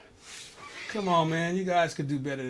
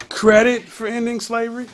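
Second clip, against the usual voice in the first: disbelieving, louder and higher echo of the headline; incredulous; 7 semitones higher, 8 dB louder than usual. Real, not acted. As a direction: repeat it as if you cannot believe it.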